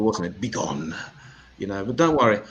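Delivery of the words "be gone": low, solemn biblical pronouncement. Shown as a demonstration. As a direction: intone it like a prophet. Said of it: "That's very biblical".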